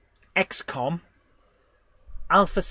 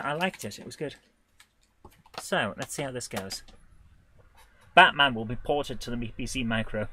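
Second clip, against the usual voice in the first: ordinary delivery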